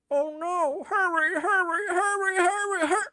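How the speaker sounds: very high, loud and frantic; hammed-up panicked plea, very high and loud; panicked cry. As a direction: shriek it, desperate for speed. Reads a panicked character line.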